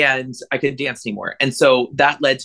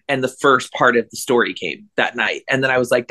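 normal talking voice

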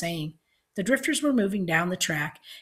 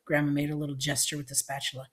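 ordinary delivery

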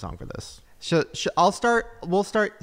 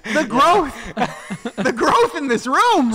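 high, loud, drawn-out exclamation; mock rallying cry; 8 semitones higher, 8 dB louder, slower than usual. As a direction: proclaim it loudly like a joke slogan. Mock rallying cry amid laughter.

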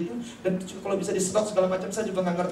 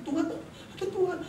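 awed prayer; 11 semitones higher, 5 dB quieter than usual. Retold or imitated. Retold awed realisation in prayer.

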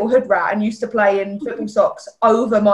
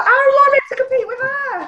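much higher, half-crying comic wail; mock whiny despair; 14 semitones higher than usual. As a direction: whimper it like a hopeless teen. Retelling her own panic.

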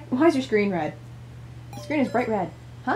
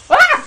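sharp yelp of fright; sudden scared shriek; startled scream; 12 semitones higher, 8 dB louder than usual. Real, not acted. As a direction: jolt and cry out. Jump scare while playing.

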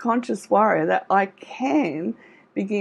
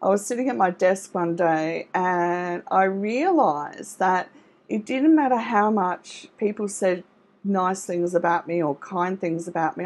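ordinary talk, nothing marked

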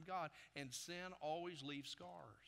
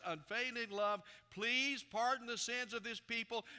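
loud, raised preacher's reading voice; forceful scripture reading; 7 semitones higher, 8 dB louder than usual. A narrator's reading. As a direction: proclaim the Lord's words to the whole congregation. Reads scripture aloud at full force.

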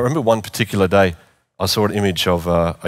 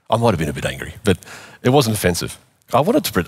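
usual speaking voice

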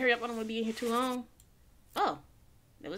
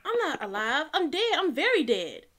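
loud protest; 4 semitones higher, 7 dB louder than usual. Real, not acted. Protests to game caller after scare.

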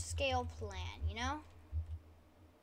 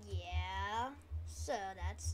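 ordinary talk

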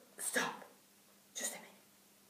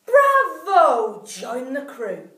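loud bravo then slow join the crew; cheering shout; 3 semitones higher, 10 dB louder, much slower than usual. A narrator's reading. Two animals welcoming the vulture, loud cheer. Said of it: "the other two shouted".

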